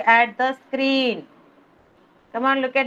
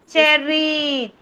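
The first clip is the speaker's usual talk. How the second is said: ordinary talk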